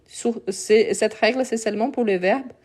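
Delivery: usual speaking voice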